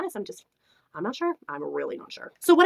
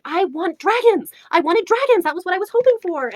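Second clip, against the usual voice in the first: high, loud, worked-up plea; emphatic craving; 8 semitones higher, 6 dB louder than usual. Retold or imitated. Reviewer at her shelves wanting dragons, high and insistent. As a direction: demand it with pitch way up.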